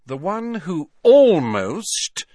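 "almost" stretched out, high and loud; heavy emphasis on almost. A narrator's reading. Hermione describing her date, great emphasis on "almost". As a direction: lean hard and slowly on "almost". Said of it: "great deal of emphasis".

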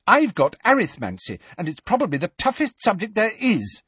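worked-up rant; Hermione agitated. A narrator's reading. Hermione snappish.

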